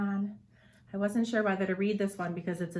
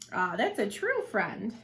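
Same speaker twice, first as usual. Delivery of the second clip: usual speaking voice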